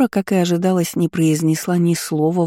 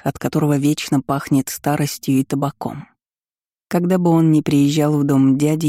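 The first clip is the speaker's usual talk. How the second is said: ordinary delivery, nothing marked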